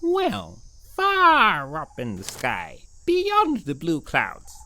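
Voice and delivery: low, slow-spoken pigeon character; a lightly voiced kindly pigeon; pigeon puppet voice. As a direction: play Mister P the friendly pigeon. Pigeon tells of Birdland.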